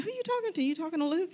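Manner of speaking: high incredulous asking, lightly acted; puzzled questioning. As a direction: retell it as a high, curious query. Retelling own puzzled question.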